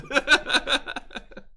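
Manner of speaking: high, prolonged laughter; hearty laugh. Real, not acted. Still tickled by own story, hearty laugh. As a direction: keep cracking up.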